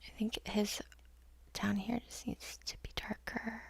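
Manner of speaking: soft whispered note to herself. Real, not acted. Painter judging her painting, whisper. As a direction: mutter it almost under the breath.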